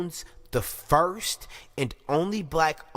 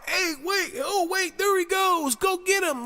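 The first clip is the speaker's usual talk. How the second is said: very high, loud put-on cry; mock alarm call; 5 dB louder than usual. Mock officer spotting the killer, high-pitched alarm. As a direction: squeal it like a useless bystander spotting a runaway.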